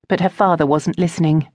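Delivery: ordinary delivery, nothing marked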